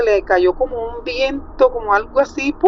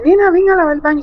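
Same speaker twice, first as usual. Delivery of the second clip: mother calling her daughter, higher and louder; a scolding mother's call down the hall; 10 semitones higher, 6 dB louder, faster than usual. Retold or imitated. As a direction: call her across the house to come clean.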